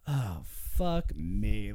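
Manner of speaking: slow low groan of despair; weary groan. Retold or imitated. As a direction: drag it out, fed up. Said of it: "And Schlereth was like".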